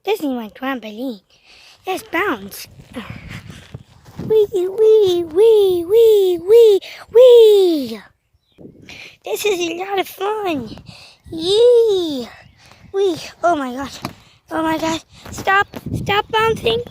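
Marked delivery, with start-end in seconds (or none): 4.3-8.0 higher and louder, growing whoops; loud, higher trampoline wees; excited bouncing. Pretend. 15.3-16.8 higher, urgent plea; higher, pleading stop. Pretend. Toy begs the other to stop.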